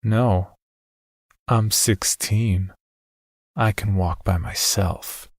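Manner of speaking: slow mocking echo; sarcastic. A narrator's reading. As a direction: mimic the father's words with contempt. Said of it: "Toby said sarcastically".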